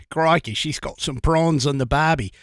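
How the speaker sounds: put-on jokey Aussie accent with stock slang; mock Australian. Host joking about Becky down under, mock Australian accent. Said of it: "our Australian contingent".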